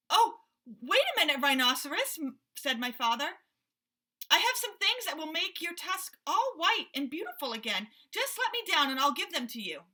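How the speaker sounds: high, loud hurried plea; eager pleading. Acted. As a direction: plead to be let down. Father pleads with rhinoceros.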